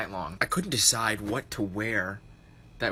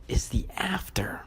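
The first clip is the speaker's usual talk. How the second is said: whispered reveal; 7 semitones higher than usual. Acted. Reveal of the after shot.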